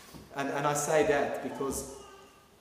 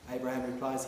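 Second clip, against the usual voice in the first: usual speaking voice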